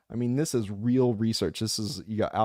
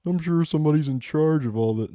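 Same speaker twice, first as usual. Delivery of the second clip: loud, higher mock scoffing; mocking dismissal; 7 semitones higher, 8 dB louder than usual. Mimicking elites brushing off a doubter, loud and mocking. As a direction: scoff loudly, sing out fake agreement. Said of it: "best tactic is to be like".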